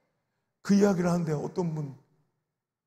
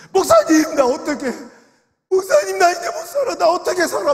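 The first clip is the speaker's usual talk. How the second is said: high, loud, sobbing plea; retold wailing of a bereaved mother; 9 semitones higher, 10 dB louder than usual. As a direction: cry out like a grieving mother begging. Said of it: "막 우는 거예요".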